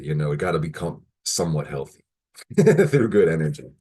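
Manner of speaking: ordinary talk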